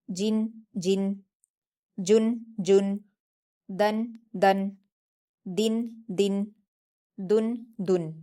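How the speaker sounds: each syllable pair drawn out slowly, tanween endings crisp; slow careful recitation drill. Shown as a demonstration. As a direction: sound out every pair slowly like a Quran teacher. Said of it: "देखिए रवानगी के साथ".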